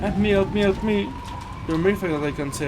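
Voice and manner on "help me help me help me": loud, high, desperate pleading; panicked cry for help. Acted. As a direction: yell for help in terror. Trapped in creepy room.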